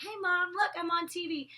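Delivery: lightly voiced kid calling to his mom; mock-childlike excited call; little boy voice. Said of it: "a little boy telling his mom".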